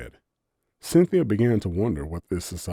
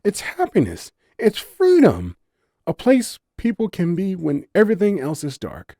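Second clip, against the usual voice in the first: higher, rapt and dreamy; blissful fawn; 12 semitones higher, 8 dB louder than usual. A narrator's reading. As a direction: savour every word with joy. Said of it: "voice full of bliss".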